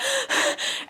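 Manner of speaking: acted out-of-breath panting; catching breath. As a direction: imitate gasping for air, voiceless. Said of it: "trying to catch my breath".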